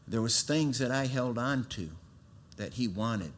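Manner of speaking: normal talking voice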